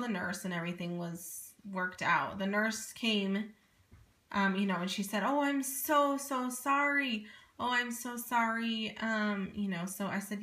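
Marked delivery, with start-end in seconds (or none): 5.2-9.0 high, slow, overdone sorry; gushing apology. Retold or imitated. Imitating the nurse gushing sorry to the waiting mother. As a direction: mimic a syrupy nurse apologizing.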